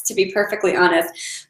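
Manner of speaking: ordinary talk, nothing marked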